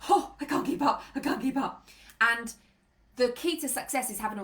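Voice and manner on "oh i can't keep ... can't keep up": higher, breathless overwhelmed voice; flustered acted fretting; mock panic. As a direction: imitate a frazzled nervous system. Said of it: "Your nervous system is like".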